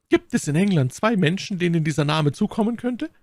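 high rhetorical question; indignant Milady. Acted. Milady hints to Felton who the villain is, indignant.

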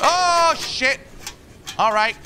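sudden loud high yelp; startled. Real, not acted. Gamer startled by a sudden scare, yelping. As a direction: jump at the fright.

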